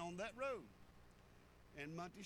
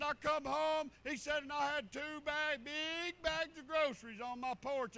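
higher and louder, eager telling; excited testimony; 5 semitones higher, 6 dB louder than usual. Retold or imitated. Man telling the church of groceries, excited and raised. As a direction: burst out with the news of the gift.

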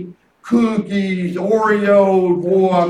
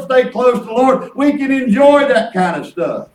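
louder, driven preaching voice; 2 semitones higher, 4 dB louder than usual. Acted. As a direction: preach it with full force to the congregation. Preaching about enjoying life.

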